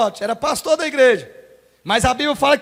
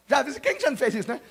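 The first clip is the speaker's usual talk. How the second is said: ordinary talk, nothing marked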